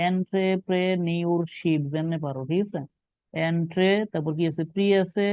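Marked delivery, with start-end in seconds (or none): 0.1-1.8 entrepreneurship split slowly into its parts; slow spelling-out. Shown as a demonstration. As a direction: stretch entrepreneurship out piece by piece. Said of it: "এইভাবে বানাও". (entrepreneurship ধীরে ভেঙে ভেঙে বলা)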